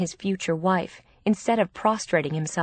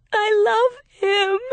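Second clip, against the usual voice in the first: slow, high, loud wail; desperate, lovesick sob, pitched way up; sobbing; 8 dB louder, slower than usual. A narrator's reading. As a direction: drag it out in a tearful wail. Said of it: "then started to sob".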